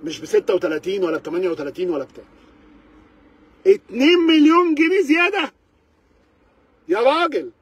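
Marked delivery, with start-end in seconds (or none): 3.7-7.5 the figure yelled out high and louder, in disbelief; outraged exclamation. Real, not acted. Reviewer fuming at dealers over a two-million markup, outraged yell. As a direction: shout the number like it's an insult.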